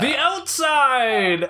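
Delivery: high, long stretched-out reveal; triumphant punchline. Pretend. Host revealing the joke's answer, hammed-up drawn-out. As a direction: belt out the answer and hold it.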